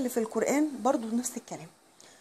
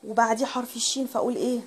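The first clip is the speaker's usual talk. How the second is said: ordinary talk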